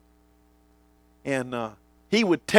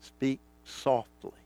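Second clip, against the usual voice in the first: quiet quote; 6 semitones lower, 11 dB quieter, slower than usual. Retold or imitated. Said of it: "Audrey says".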